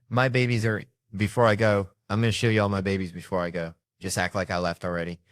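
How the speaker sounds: ordinary talk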